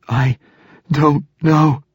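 high, strained sobbing voice. A narrator's reading. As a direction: squeeze the words out between sobs. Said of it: "she managed between sobs".

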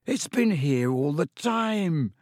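high, drawn-out delighted exclamation. A narrator's reading. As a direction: marvel at it, stretching the words out. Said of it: "said Ron delightedly".

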